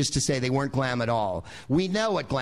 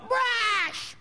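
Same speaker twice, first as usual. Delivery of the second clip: much higher, one-word Muppet-like bark; grunting [name]; 4 dB quieter than usual. Retold or imitated. [name] demands a brush from makeup girls, high Muppet bark. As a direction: blurt it like an inarticulate Muppet. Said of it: "can't articulate".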